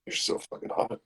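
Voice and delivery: whispered, hushed and intimate; lustful whisper.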